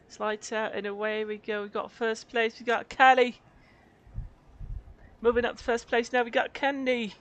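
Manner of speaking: high-pitched British race commentary; British accent. Pretend. Showing off a UK voice as race commentator, high British accent. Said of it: "You sound British".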